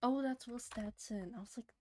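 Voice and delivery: breathy whispered aside. Real, not acted. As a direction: whisper it to yourself. Noticing song quietly.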